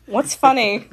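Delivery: ordinary talk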